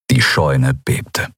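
usual speaking voice

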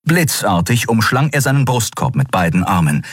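ordinary delivery, nothing marked

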